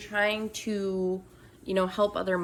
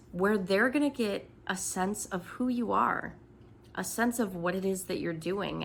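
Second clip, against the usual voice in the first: ordinary talk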